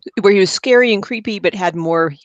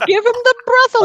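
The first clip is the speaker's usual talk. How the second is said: high, loud mock shout; mock movie cry; 6 dB louder, much faster than usual. Teasing a friend's wrong word, mock dramatic cry. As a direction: holler it in a put-on high voice.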